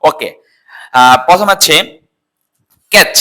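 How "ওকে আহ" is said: ordinary talk, nothing marked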